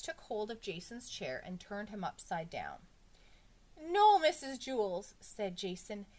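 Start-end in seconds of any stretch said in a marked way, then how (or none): 3.8-5.1 high, loud, stretched-out no; drawn-out schoolboy objection; alarmed protest. A narrator's reading. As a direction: protest loudly to the teacher. Pupil protests to teacher.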